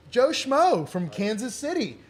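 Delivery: slow, drawn-out mock introduction; mock announcer. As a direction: announce a nobody grandly. Mock announcing unknown surfer.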